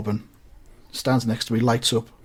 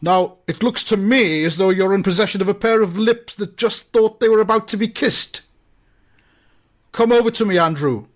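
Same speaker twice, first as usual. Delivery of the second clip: higher-pitched, formal summons; stately civic voice, raised and insistent; commanding alderman; 6 semitones higher, 3 dB louder than usual. Acted. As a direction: play an imperious official giving orders. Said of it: "And he says".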